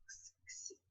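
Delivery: x, x as a voiceless ks; the letter x as a plain ks; bare ks sound. Shown as a demonstration. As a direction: hiss out the x as ks. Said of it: "todo x".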